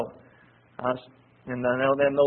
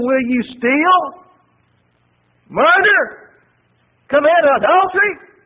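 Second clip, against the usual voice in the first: preaching as Jeremiah; 10 semitones higher, 7 dB louder than usual. Acted. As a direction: voice of Jeremiah, accusing. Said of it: "And he says to them".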